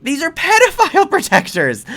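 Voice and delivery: high, loud furious rant; real anger. Real, not acted. Vlogger ranting at the hockey players, furious and loud. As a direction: let the outrage boil over. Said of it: "But I'm angry".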